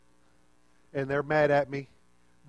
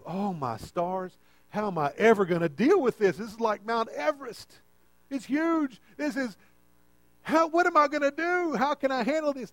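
rising, higher and higher in mock alarm; mock panic; 6 semitones higher than usual. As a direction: overdramatic fretting over a small problem. Acting panicked overreaction.

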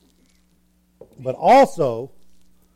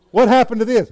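higher-pitched mock parental nagging; exasperated parent; 6 semitones higher, 5 dB louder than usual. Parent asking about the messy room, mock exasperation. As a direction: demand an explanation from the kid.

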